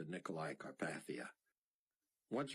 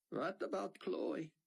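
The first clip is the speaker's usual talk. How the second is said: slow, higher worried question; Sion's voice; 11 semitones higher than usual. A narrator's reading. Sion asking Buck after his rescue, worried. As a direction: ask it slowly with concern. Sion asks about Chloe.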